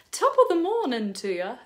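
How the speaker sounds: a lightly put-on Irish accent, drifting British; half-hearted attempt at an Irish brogue. Vlogger tries an Irish greeting for Saint Patrick's Day, in a weak Irish accent. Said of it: "try and say something in an Irish accent".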